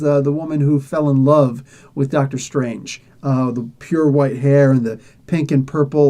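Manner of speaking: ordinary delivery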